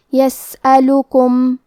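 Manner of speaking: یسألکم slow with both u vowels clear and even; clear dammas. Shown as a demonstration. Teacher shows clear dammas to students, slow recitation. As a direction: give both vowels of یسألکم equal weight. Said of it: "دونوں پیش کو ظاہر کریں گے".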